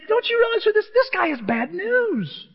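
hammed-up panicked warning; incredulous protest. Ananias warning God about Saul, squealing in disbelief.

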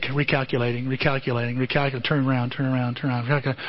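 lightly imitated navigation robot repeating itself; flat-toned car navigator; GPS voice. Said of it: "the G P S is saying".

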